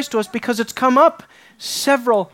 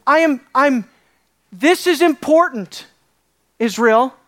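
higher-pitched, emphatic divine command; insistent command; 4 semitones higher than usual. Acted. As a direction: lay down a command that allows no choice. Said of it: "More of a command".

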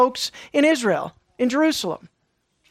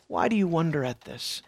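softer, lower, puzzled reading of the question; quiet musing reading; 6 semitones lower, 8 dB quieter than usual. Shown as a demonstration. Preacher tries out Peter's question quietly for the congregation. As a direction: ask it softly, almost to yourself. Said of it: "Imagine the scene".

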